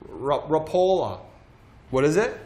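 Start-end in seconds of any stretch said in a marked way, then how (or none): 0.1-1.2 hesitant try at "Rapala" as ruh-POH-luh; trying a pronunciation. Shown as a demonstration. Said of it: "I think it's Rapala".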